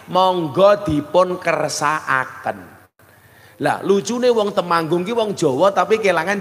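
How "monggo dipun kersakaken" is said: monggo dipun kersakaken said slowly and evenly; slow refined offer. Shown as a demonstration. Host offering food to guests, slow refined offer. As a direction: offer it slowly, each word gently spaced. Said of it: "bahasa Jawane alus jenengan nyuguh tamu".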